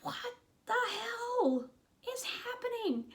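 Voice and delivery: high, cracking exasperation at the movie; exasperated disbelief. Real, not acted. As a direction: throw up your hands and squeal it out. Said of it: "And I'm like".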